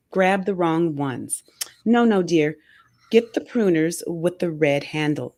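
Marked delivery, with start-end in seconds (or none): none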